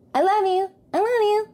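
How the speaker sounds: very high, cutesy repeated affection; gushing sign-off. Real, not acted. Thanking listeners at the end of the show, high-pitched gushing.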